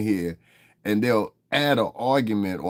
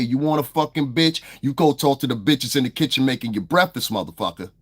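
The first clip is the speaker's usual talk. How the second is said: heated, raised-voice warning; angry threat; 3 dB louder than usual. Real, not acted. Streamer warning a hostile chatter, angry and forceful. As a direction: lay into the heckler with real anger.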